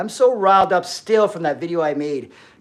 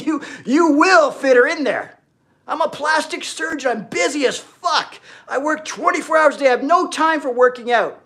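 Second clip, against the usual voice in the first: mock busy surgeon; 7 semitones higher than usual. Mocking a busy plastic surgeon.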